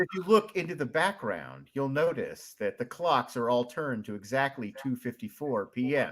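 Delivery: low mystery-video narrator voice; Nightmind. Acted. Host describing an ARG clue as a YouTuber, low narrator impression. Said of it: "do you do a Nightmind impression?".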